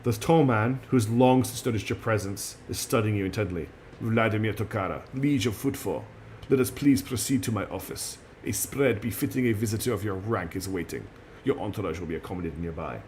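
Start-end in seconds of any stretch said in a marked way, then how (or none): 4.0-13.0 put-on lower, softened Russian-accented voice; formal host with a put-on Russian accent; calm, quiet Russian accent. Liege invites guest to his office, calm Russian accent. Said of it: "his speech calm and quiet".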